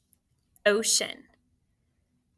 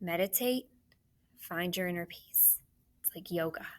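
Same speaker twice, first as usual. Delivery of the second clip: much softer, hushed and serene; calm meditation voice; 2 semitones lower, 8 dB quieter than usual. Shown as a demonstration. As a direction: soothe like a yoga teacher guiding calm. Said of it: "do you remember that signal".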